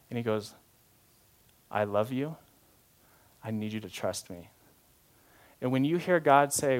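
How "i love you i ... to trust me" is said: low, very soft and tender; loving reassurance. Acted. God at eye level asking for trust, loving. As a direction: gently ask for trust, pausing between phrases. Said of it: "in that tone".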